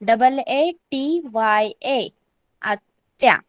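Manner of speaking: letters spaced out, then आत्या in two parts; spelling out pronunciation. Shown as a demonstration. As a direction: slowly spell डबल ए टी वाई ए, then say आत्या. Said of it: "कैसे pronounce करेंगे हम".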